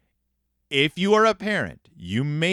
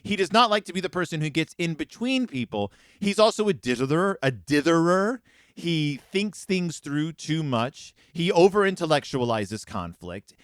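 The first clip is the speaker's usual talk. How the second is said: ordinary talk, nothing marked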